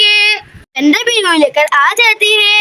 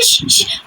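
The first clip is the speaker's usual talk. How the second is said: breathy shush to drive off a bird; unvoiced shoo; shooing hiss; 4 dB quieter than usual. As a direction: hiss the parrot away. Shooing the parrot away.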